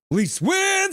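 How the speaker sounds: very high, stretched celebration cry; mock fan cheer. Reads a fan's celebrating chat message.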